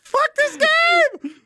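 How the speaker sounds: high, drawn-out cry of frustration; real high-pitched gamer rage; frustrated yell. Real, not acted. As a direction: wail in rage at the game. Rage at the game.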